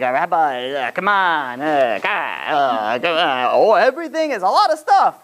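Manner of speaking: hammed-up Italian mobster pleading, drawn-out groans; Italian mafia whine. Student begs rabbi off, Italian mafia whine. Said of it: "they were becoming Italian mafias".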